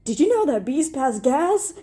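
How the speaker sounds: a chirpy, bouncing bit of trivia; perky question. A narrator's reading. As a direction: blurt it out cheerily. Character blurts odd question happily.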